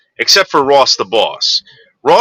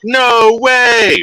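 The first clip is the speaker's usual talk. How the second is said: a hammed-up gasp of disbelief; mock disbelief; 11 semitones higher than usual. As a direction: fake shock, pitched way up.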